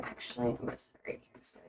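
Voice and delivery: a whispered, quick aside to herself. Real, not acted. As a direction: breathe it out as a private aside. Muttered aside while moving.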